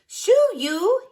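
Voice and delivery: high, loud shoo; Frank shooing. A narrator's reading. Frank shooing the owl away, high and loud. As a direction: chase the owl off. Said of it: "he says".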